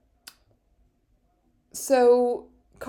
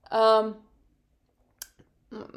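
normal talking voice

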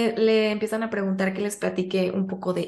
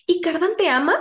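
prying question; 6 semitones higher than usual. Retold or imitated. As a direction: press her with the question. Mimics Nicasia's pointed question.

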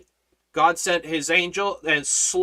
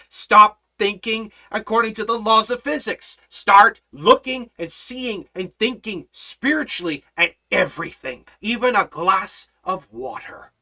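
louder, driving sermon delivery; full-force preaching; 7 semitones higher, 5 dB louder than usual. Acted. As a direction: preach it to the back row.

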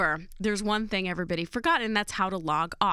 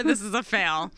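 ordinary delivery

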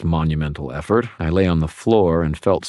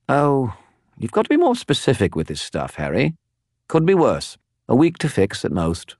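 higher, chattering spirit voice; a talkative skull spirit, pitched up; Bob the skull; 8 semitones higher than usual. Acted. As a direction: prattle on like a gossipy spirit. Bob answers Harry.

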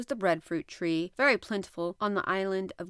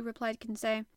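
plain reading voice

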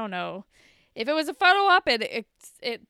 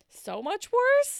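"so much worse" pitched higher, louder and slowed; emphatic; 11 semitones higher, 8 dB louder, slower than usual. Real, not acted. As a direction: lean hard on "so much worse". Stressing how bad it got.

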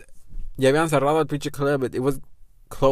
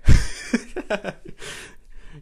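high, breathy laugh; 9 semitones higher, 7 dB louder than usual. Real, not acted. Laughs at the ruined night.

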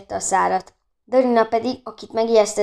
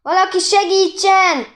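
high, loud, stretched-out plea; drawn-out distress call; shouted cry for help; 10 semitones higher, 6 dB louder than usual. A narrator's reading. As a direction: yell for anyone to come to the rescue. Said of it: "kiabálta".

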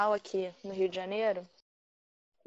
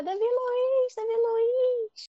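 put-on high, loud child's cry for her idol; little girl yelling; 13 semitones higher, 6 dB louder, much slower than usual. Daughter at four shouting to her favorite player, high and loud. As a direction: shout the player's name like an excited four-year-old.

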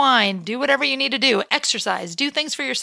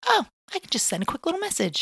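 lightly acted higher, softer inner voice; casual self-talk; 6 semitones higher, 6 dB quieter, faster than usual. Phone addict talking herself into texting, lightly mocked. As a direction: toss it off as a harmless little idea, softly. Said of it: "it's just like".

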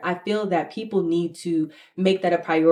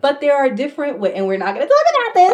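laughing; 8 semitones higher, 5 dB louder, faster than usual. Real, not acted. Laughing self-interruption.

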